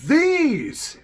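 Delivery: very high, loud exclaim; loud, high mock friend's cry; excited reveal. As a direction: shout it like a big reveal. Friend shows her chest.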